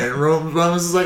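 ordinary delivery

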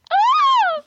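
shrieking mock scream breaking into a laugh; high laughing scream. Redoing the film's scream while laughing. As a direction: scream it, then crack up. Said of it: "I loved when Ajay would scream".

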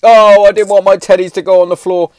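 put-on high, loud whining kid; high loud whiny child voice. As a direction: mimic the tantrum. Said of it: "it was like".

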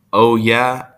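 loud, high scoffing challenge; loud taunting "oh yeah"; challenging oh yeah. Acted. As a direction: call his bluff, mocking. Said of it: "asked his buddy".

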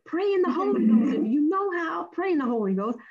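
high, loud urging to pray; rally the group like a preacher; urgent command. Retold or imitated. Letitia ordering the nervous group to pray, loud and urgent.

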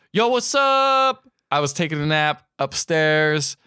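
drawn-out, high-pitched dude greeting; laid-back Peter. Retold or imitated. Peter greeting his girlfriend, slow mock dude voice. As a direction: stretch it like a slacker dude. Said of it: "And then Peter's like".

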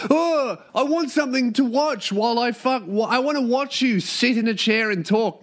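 high, whiny mock-viewer voice. Host parodying YouTube viewers who want to watch, mocking.

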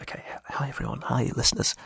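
whispered hello; soft ASMR whisper; whispering into the phone. Real, not acted. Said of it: "we've gone a bit A S M R".

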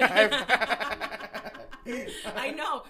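loud and laughing. Real, not acted. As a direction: laugh through the words. Laughing banter.

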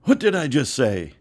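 mother yelling. Retold or imitated. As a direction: call him out sharply. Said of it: "she yelled this out".

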